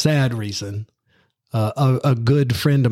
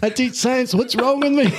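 higher, slow self-mocking squeal; retelling his own blunder in a hammed-up high voice; mock self-scolding; 12 semitones higher, slower than usual. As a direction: rise ever higher at your own stupidity. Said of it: "I was like".

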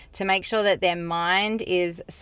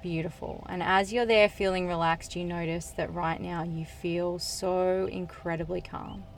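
soothing trance induction, unhurried and hushed; guided hypnosis; 6 dB quieter than usual. Acted. Leads a hypnosis session.

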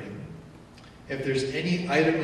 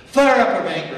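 mock flare of anger; 6 semitones higher, 13 dB louder than usual. Illustrating sudden anger.

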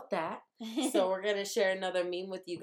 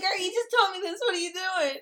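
much higher and louder, fed up; exasperated squeal; 12 semitones higher, 5 dB louder than usual. Retold or imitated. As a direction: squeal it at the friend repeating her story. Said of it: "I would be like".

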